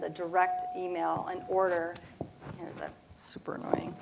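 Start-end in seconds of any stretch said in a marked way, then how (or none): 2.6-4.0 breathy whispered complaint under the breath; whispered aside. Real, not acted. Speaker grumbling to the room about the echo, whispering.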